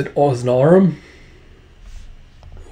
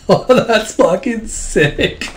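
real laughter through the words, high and slow; delighted laughing; 8 semitones higher, much slower than usual. Real, not acted. Game master thrilled by the story, laughing hard.

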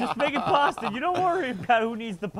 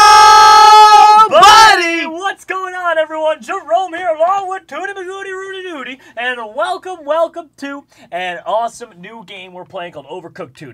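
loud, high YouTuber intro; YouTube intro; 6 semitones higher, 16 dB louder than usual. Acted. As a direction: hype up the audience.

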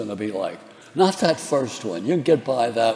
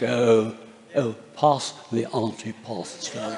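a lightly imitated slow, soft English accent; a hint of Boris Karloff drawling the line slowly; 3 semitones lower, 3 dB quieter, slower than usual. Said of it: "He, he'd say things like".

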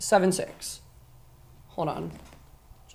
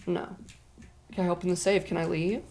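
ordinary talk

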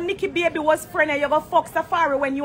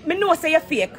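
ordinary talk, nothing marked

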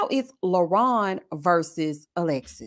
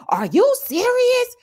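much higher, slow "are you serious"; indignant yell pitched way up; mock yell; 13 semitones higher, 3 dB louder, slower than usual.

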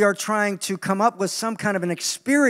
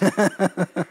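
laugh. Real, not acted. Laughs at own line.

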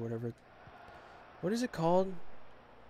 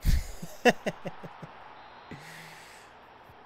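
burst of laughter; 3 semitones higher than usual. Real, not acted. Gamer laughing at his match, real burst of laughter. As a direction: crack up, then wheeze out.

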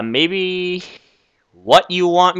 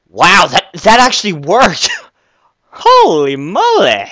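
amazed disbelief; 3 semitones higher than usual. Acted. Spawner actually worked.

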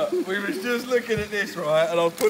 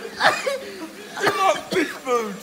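laughing; 10 semitones higher, much slower than usual. Real, not acted. Eating dog food amid laughter.

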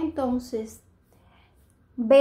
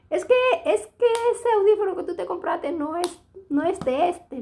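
high, louder voice of the shop attendant explaining; quoting the clerk; 8 semitones higher, 4 dB louder than usual. Retold or imitated. Shop attendant explaining the wrong model to customer, high-pitched. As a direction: play the attendant, pitched well up.

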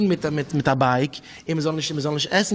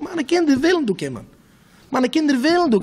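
high, emphatic retold answer; insistent reply; 7 semitones higher than usual. Answering someone who asks about his children, high insistent reply. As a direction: insist on it, pitched up.

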